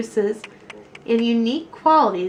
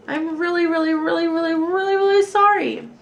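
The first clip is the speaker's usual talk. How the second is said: put-on higher, earnest little girl's apology; pleading child; 7 semitones higher, 3 dB louder than usual. A narrator's reading. Lily apologising to everyone in her story, pleading. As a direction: beg forgiveness, building on each really.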